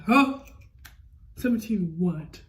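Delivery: loud startled huh, then slow puzzled question; shocked disbelief. Retold or imitated. People told she is seventeen, loud shocked disbelief. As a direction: blurt it out, stunned. People hearing her age.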